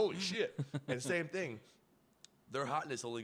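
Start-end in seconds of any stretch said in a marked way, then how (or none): 0.0-0.6 amazed. Retold or imitated. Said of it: "I was like".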